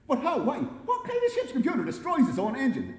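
high, loud panicked questions; panicking. A narrator's reading. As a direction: fire off questions in a rising panic. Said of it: "He was now panicking".

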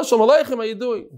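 bright, high hello to a friend; cheerful greeting. Retold or imitated.

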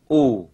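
"ù" as a separate oo sound, dropping low; isolated vowel. Shown as a demonstration. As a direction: say "ù" alone, falling in pitch. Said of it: "sau đó chuyển thật nhanh sang đọc âm".

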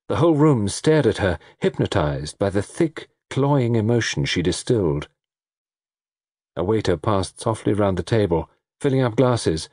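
ordinary delivery

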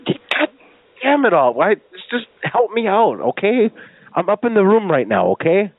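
high-pitched, frustrated begging; exasperated pleading. Pretend. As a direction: plead for help at the end of your patience. Caller pleads with baffled clerk.

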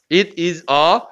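"It is a" with each word separate; over-careful, announcer-like "It is a", unlinked; word-by-word pronunciation. Shown as a demonstration. Teacher showing students dictionary-like reading, each word separate. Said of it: "이걸 하나하나 발음하면".

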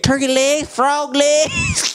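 high, gleeful country man voice. Retold or imitated. Teacher lists his favourite country foods, high voice. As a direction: list them off like a delighted old country man.

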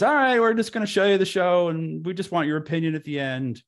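high, nasal-free even drone of instructions; Larry David impression. Larry David impression, high, briefing the new editor. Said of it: "he says".

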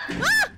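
sudden high shriek of fright; jump-scare scream; startled scream. Real, not acted. Caught by the teacher.

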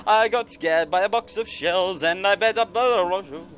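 normal talking voice

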